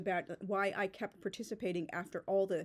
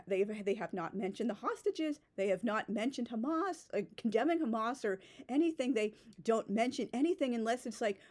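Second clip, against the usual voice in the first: ordinary talk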